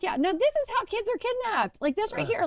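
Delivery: high mock stranger voice; Texan man. Stranger explains kidnapping risk, high mock voice. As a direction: play the amused cowboy. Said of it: "And he's like".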